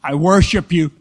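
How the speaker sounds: loud mock invocation; mock worship. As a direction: lead the mock prayer in a loud voice. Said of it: "Now say this with me".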